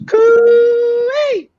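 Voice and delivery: shrill Aussie bush call, very high and loud; loud high coo-ee call. Retold or imitated. Imitating the koala toy, a loud high Australian coo-ee. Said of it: "and then it goes".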